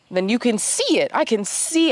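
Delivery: ordinary talk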